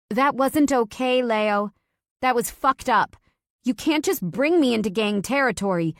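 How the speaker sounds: wife shouting at him over gang territory; angry shout. Acted. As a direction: lay into him, furious. Wife angrily confronting husband.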